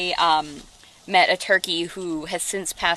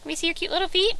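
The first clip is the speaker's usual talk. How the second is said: cooing pet talk; 10 semitones higher, faster than usual. Real, not acted. Talking to the turkey Malika on camera, high cooing baby talk. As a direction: coo at her like a beloved pet.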